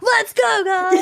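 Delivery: high, drawn-up rallying cheer; hype person. Retold or imitated. Talk-show hype person rallying the audience, excited. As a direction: pump up the crowd in a high voice. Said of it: "they have a hype person".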